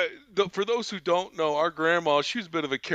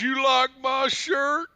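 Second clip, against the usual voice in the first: slow, raised uncle voice; Uncle Mike; 7 semitones higher, 4 dB louder, slower than usual. Retold or imitated. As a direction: call it out slowly, pitched up. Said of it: "He goes".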